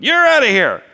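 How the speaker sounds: high, loud angry dismissal; explosive acted shout. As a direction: yell like a ringmaster firing his act. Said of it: "Milo's shouting scared Stacy's pup".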